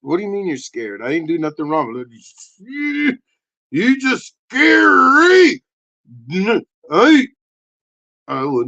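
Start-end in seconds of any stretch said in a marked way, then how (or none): 2.6-7.3 puppet voice. Acted. Puppet voice answers.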